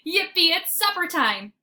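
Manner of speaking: high, loud yelp of joy; a happy dog shouting for dinner; excited. A narrator's reading. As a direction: burst out with glee. Dog excited about supper.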